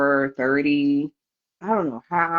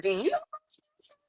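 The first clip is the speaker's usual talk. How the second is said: teasing question; 9 dB quieter, faster than usual. Retold or imitated. Mocking the nurse back.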